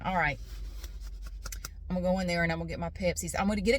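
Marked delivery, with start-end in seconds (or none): none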